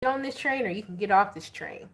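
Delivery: ordinary delivery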